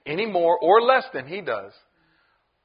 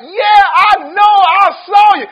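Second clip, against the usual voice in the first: accusing shout; 13 semitones higher, 13 dB louder than usual. Retold or imitated. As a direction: shout it like you caught him. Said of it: "another one was like".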